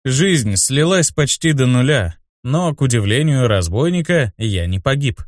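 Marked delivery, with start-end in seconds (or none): none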